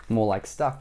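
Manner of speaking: usual speaking voice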